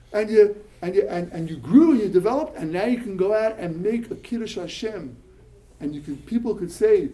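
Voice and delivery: ordinary delivery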